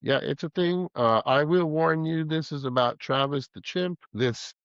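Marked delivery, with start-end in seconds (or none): none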